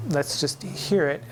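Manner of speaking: ordinary delivery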